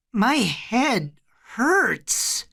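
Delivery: put-on high, slow, trembling child; frail high voice; weak, trembling. A narrator's reading. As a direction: draw it out weakly, like a hurt little boy. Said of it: "in a weak, trembling voice".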